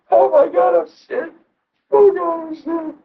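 high, tearful, breaking cry of confession; retold sobbing. Reliving crying to God in the bathroom, sobbing and high. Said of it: "I was crying in the bathroom".

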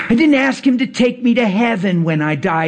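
ordinary delivery

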